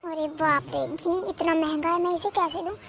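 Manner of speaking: low, hushed mutter to oneself; dismayed aside. Acted. Sister-in-law privately balks at lending costly ghee, muttering low. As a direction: mutter it under your breath, pitched well down.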